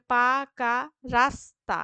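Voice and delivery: পাকা রাস্তা as পা, কা, রাস, তা, loud; split into syllables. Shown as a demonstration. Teacher dividing the phrase, slow separate beats. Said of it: "শেষ দলগুলির মধ্যে".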